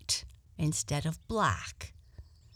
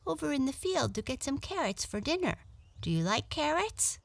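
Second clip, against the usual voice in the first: high, quick animal chatter; friendly little rabbit; 8 semitones higher, faster than usual. Acted. Rabbit off for dinner carrots, high and quick. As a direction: pitch it up and answer quickly.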